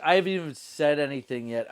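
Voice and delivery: normal talking voice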